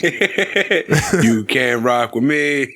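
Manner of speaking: very slow stretched taunt; slow drawn out. Pretend. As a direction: drag every word. Drawn out quote.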